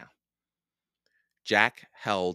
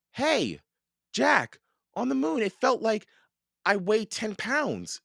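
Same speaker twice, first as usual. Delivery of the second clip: put-on high-pitched little girl voice; voiced young girl; 3 dB louder than usual. A narrator's reading. Annie stuck in mud to Jack, high and complaining.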